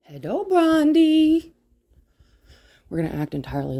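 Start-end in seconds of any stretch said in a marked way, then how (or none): 0.0-1.5 high, slow, loud greeting; high drawn-out hello for a pet; dog greeting. Real, not acted. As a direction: stretch it out sweetly to the dog.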